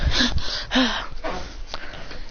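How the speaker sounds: long, soft, falling sigh. Acted. Gladion reacting to the story, soft long sigh. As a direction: exhale in an acted sigh. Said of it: "He goes".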